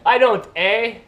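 loud, high mock Canadian retort; mock Canadian accent. Vlogger answering teasing about the accent, mock Canadian accent. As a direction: belt it out in fake Canadian.

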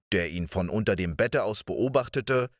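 usual speaking voice

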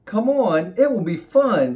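high, loud and coaxing; ladybug voice. Acted. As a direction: cheerfully coax a grumpy friend. Ladybug coaxes the cat.

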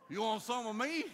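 loud, high mock taunt; hammed-up little kid daring a fight; cocky challenge. Emboldened kid Jesse challenges the attacker, loud high mock taunt. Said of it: "he started doing his little".

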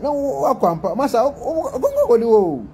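mock scolding voice; mock warning voice. Mocking the voice message.

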